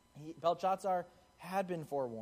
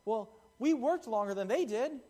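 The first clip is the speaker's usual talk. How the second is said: mock indignant whine, higher and louder; upset complaint; 7 semitones higher, 6 dB louder than usual. All-day workers complain to the owner, indignant. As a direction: play the sore all-day worker, huffy. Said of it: "they start getting upset".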